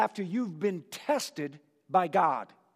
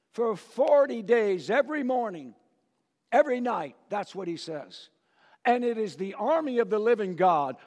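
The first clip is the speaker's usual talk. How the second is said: ordinary talk, nothing marked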